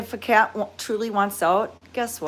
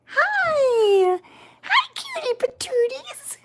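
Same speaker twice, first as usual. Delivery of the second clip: high squeal into a whisper; much slower than usual. Real, not acted. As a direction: coo at the kittens. Greeting kittens.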